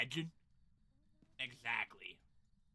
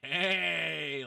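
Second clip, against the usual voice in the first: excited; 13 dB louder than usual. Real, not acted.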